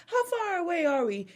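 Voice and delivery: high-pitched put-on accent; Dorit's fake mixed accent. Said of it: "every time I do her impression".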